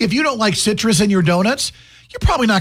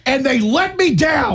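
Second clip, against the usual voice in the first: high, drawn-out hammed-up complaint; mock grievance; 8 semitones higher, slower than usual. Host complaining the Quick Star had no donuts, mock grievance. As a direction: play the wronged customer, stretching it out.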